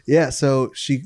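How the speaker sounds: usual speaking voice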